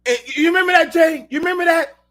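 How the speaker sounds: loud, high call to a buddy. Real, not acted. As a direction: shout it over to your friend. Calling out to Jay loudly.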